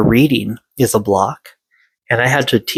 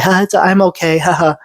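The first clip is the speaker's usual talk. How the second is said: higher, strained fake laugh pretending to be okay; forced laugh; 8 semitones higher than usual. Child pretending to be fine after being hit, forced laugh. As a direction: cover the pain with a hollow laugh. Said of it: "laughing at them harming me".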